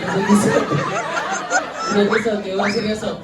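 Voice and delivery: laughing. Real, not acted. Host laughing at kid's answer.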